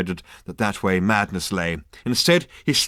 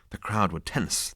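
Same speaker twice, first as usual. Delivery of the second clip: usual speaking voice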